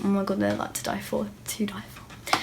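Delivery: whispered, longing over the shoes; breathless whisper. Real, not acted. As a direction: whisper it like they're a dream. Swooning over YSL shoes.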